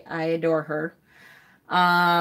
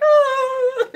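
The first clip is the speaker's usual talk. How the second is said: much higher, wailing little sob; mock sob; 5 dB louder than usual. Mother reacting to her son's confusion while sobbing over the book, a wailing sob. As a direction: let out a hammed-up crying "oh". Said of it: "I'm like".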